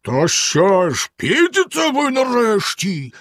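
slow, high hammed-up villain's shout; furious yell. A narrator's reading. Bluebeard shouting furiously up at his wife. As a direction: bellow up the stairs in rage. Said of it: "розлючено загорлав знизу".

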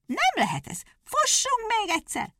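high, furious hare; furious shout. A narrator's reading. Hare, bursting with rage, demands another race. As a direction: cry out in rage. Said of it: "kiáltotta".

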